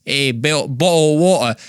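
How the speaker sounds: put-on Cockney accent. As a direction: try out a rough mock Cockney accent. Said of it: "What about some Cockney?".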